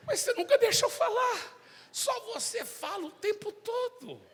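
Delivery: very high, plaintive squeal; hammed-up thwarted lament; God's mock complaint. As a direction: whine in a comically high voice about never getting a word in. Voices God complaining he cannot speak.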